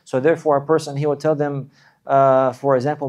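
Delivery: normal talking voice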